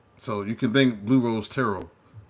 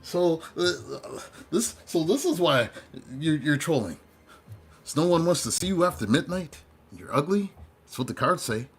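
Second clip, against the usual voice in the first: higher, sassy mock reading; mocking tarot read; 6 semitones higher than usual. Reading a troll's cards on camera, sassy higher mockery. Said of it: "I'm like".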